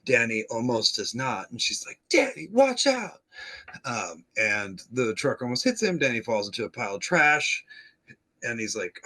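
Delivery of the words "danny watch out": high, louder yelp of warning; mock warning shout. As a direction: squeal a warning at Danny.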